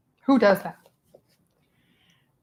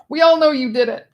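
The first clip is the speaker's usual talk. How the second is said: high, loud accusation; loud mock accusation; 5 semitones higher, 7 dB louder than usual. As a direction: call it out for everyone.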